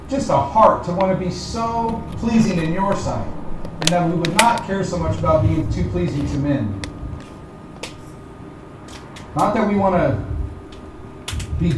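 pastoral prayer. Acted. Leads closing prayer.

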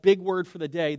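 ordinary talk

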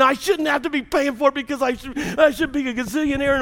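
high-pitched mock indignation; mad grumbling. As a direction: complain as the aggrieved lottery loser. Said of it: "you get mad all week long".

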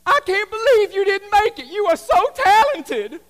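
high, loud gushing praise; doting family. Retold or imitated. Pastor voicing the family praising their child. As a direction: gush like a blind fan. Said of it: "and says".

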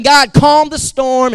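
slow, loud mock prayer shouted upward; mock demanding prayer. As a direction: bark the prayer slowly like a demand.

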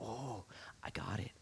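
slow, very soft whisper; whispered realization. Acted. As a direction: let it dawn on you in a whisper. Imagined hushed reaction to relationships.

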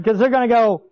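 ordinary delivery

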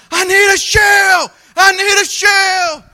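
high, slow, desperate yell for help; frantic shout. Retold or imitated. Hunter yelling to his buddy across a field, frantic. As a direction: holler across the field for ammo. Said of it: "I'm like".

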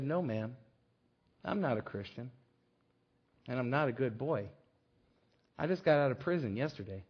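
put-on low, soft young man's reply; quiet admission. Young man correcting the grateful woman, low and quiet. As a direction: own up quietly, voice dropped low. Ex-prisoner modestly corrects her.

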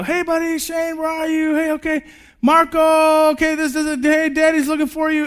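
dad calling his hidden son, forced high breezy call; fake casual. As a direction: sound breezy while panicking. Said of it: "trying to act like this is no big deal".

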